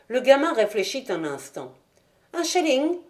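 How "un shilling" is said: put-on much higher childlike voice; a little boy asking for money; small boy. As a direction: pipe up like a greedy six-year-old. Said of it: "dit-il".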